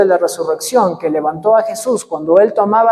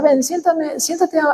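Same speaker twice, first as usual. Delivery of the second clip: softly coaxing, a touch higher; gentle invitation; 7 semitones higher, 4 dB quieter than usual. Retold or imitated. Pastor gently invites the young woman to sit by him, softly. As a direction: invite her to sit beside you, kindly.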